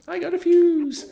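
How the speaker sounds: very high, slow, loud; playful squeal. Pretend. Gamer celebrates the new fuse, very high drawn-out cry. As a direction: draw it out in a silly high voice.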